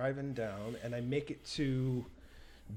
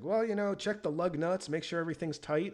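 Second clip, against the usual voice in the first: higher-pitched, casual advice voice; lightly voiced partner; 6 semitones higher than usual. Mom's partner advising a stranded driver by phone, lightly voiced higher. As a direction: lightly imitate someone giving easy advice.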